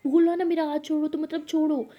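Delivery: put-on a girlfriend shouting in the car; angry shout. A narrator's reading. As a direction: snap at her to let go. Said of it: "तो lily shout कर लेती है".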